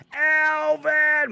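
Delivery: high, loud, drawn-out name; hyped shout-out. Real, not acted. Host calling out a chat member, hyped. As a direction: yell the name as a big welcome.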